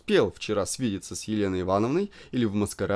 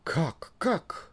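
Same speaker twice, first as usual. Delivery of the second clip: high startled question; high astonished exclamation; surprised cry; 7 semitones higher than usual. A narrator's reading. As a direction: gasp it out in surprise. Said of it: "вскричала она в удивлении".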